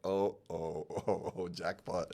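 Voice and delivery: delighted oh-oh breaking into a laugh; laughing jackpot. Retold or imitated. Hearing his cash counted at the bank, laughing delight. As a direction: let the joy spill out as a laugh. Money counter whirs, he rejoices.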